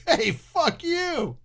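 high, slow hammed-up insult; mock road rage. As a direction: drag out a joking curse. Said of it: "mocks rude drivers".

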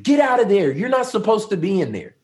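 high, loud street shout; imitating a yell. Stranger yelling at supposed intruders from the street, imitated shout. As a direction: yell it out like an alarmed onlooker. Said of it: "somebody yells out on the street".

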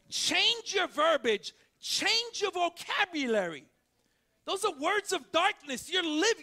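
loud, high, slow preaching voice; full-force preaching. Acted. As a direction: proclaim it to the whole church.

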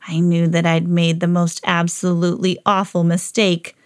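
plain reading voice

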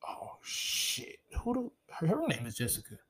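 retold quiet uh-oh moment; hushed dismay. Retold dread on hearing her name.

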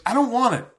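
usual speaking voice

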